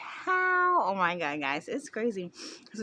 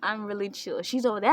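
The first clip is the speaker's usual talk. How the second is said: ordinary delivery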